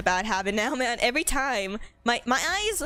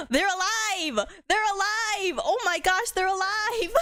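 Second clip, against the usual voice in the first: excited surprise; 7 semitones higher, slower than usual. Real, not acted. Streamer seeing idle teammates move, gasping with joy. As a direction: gasp then high, delighted "they're alive".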